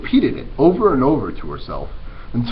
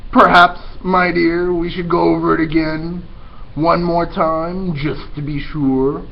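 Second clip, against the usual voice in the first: slow, louder, drawn-out villain purr; patient scheming rat; 5 semitones higher, 5 dB louder than usual. Acted. Roscuro slowly explaining the plan to Mig, louder.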